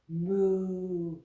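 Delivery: low drawn-out cow moo. Pretend. Teacher doing cow pose for kids, playful moo. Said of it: "And you can moo too".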